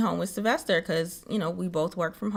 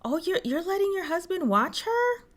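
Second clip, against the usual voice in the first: nosy onlooker; 9 semitones higher than usual. Retold or imitated. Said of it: "People would be like".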